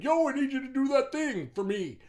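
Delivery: loud, high mock demand; pushy demand. Friend with no boundaries making demands, pushy and loud. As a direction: call out like an overbearing friend.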